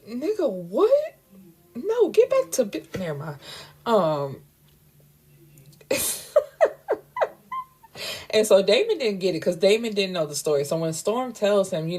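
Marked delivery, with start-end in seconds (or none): 0.1-2.8 incredulous outburst. Pretend. Narrator picturing a lover offering juice, shocked disbelief. Outraged reaction to imagined offer. 5.9-7.3 a real squealing laugh, much higher. Real, not acted. Narrator cracking up at her own joke, squealing laugh.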